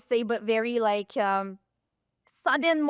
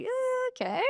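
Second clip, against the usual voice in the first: higher, unsure uh okay; awkward surprise; 12 semitones higher, 4 dB quieter than usual. Retold or imitated. Coach thrown by a golfer's blunt answer, awkward higher surprise. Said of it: "So I'm like".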